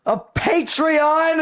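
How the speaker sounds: very high, loud, drawn-out shriek; over-the-top hype. Pretend. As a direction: scream it slowly as the grand climax. Climax of mock hype.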